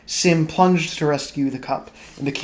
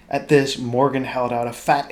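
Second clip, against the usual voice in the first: plain reading voice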